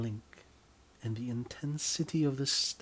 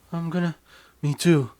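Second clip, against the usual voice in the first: loud climax cries, pitched up; crying out; 6 semitones higher, 12 dB louder, faster than usual. Acted. Lovers cry out together at the climax, loud. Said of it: "you cry out in chorus".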